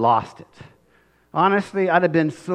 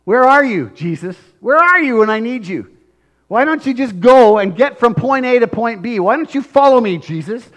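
higher, louder, exasperated; frustrated demand; 6 semitones higher, 6 dB louder than usual. Acted. Believer demanding Jesus follow, louder and higher. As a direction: push Jesus to hurry. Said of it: "the frustration".

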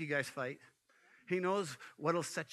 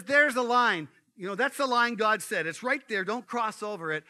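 a firm, louder warning; 3 semitones higher, 7 dB louder than usual. Acted. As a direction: lay down the rule loud and clear. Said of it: "he says".

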